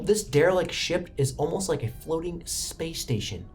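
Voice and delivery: ordinary talk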